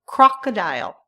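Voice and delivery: teacher's clear "Crocodile"; model word. Shown as a demonstration. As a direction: sound out "Crocodile" for repeating. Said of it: "Can you say them with me".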